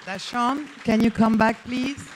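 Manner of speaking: calling loudly across the room. Real, not acted. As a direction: call him back to the stage. Calling the performer back on stage.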